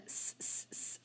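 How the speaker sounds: repeated short hissed "s" sounds; isolated letter sound. Shown as a demonstration. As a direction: say just the "s" sound, separated by pauses. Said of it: "sound for that C".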